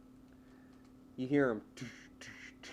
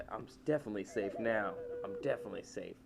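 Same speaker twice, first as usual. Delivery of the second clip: muttering to himself; 3 semitones lower, 7 dB quieter than usual. Retold or imitated. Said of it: "he's like".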